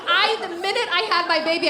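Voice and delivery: ordinary talk, nothing marked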